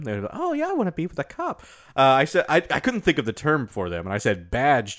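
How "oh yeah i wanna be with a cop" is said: high dreamy voice. Pretend. Voicing badge chasers, high.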